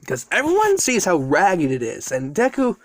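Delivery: ordinary delivery, nothing marked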